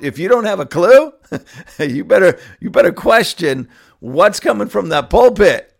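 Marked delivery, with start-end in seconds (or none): none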